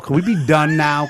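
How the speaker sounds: ordinary delivery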